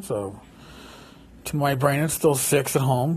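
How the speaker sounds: ordinary talk, nothing marked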